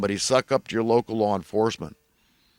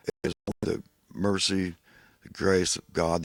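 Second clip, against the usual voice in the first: ordinary talk, nothing marked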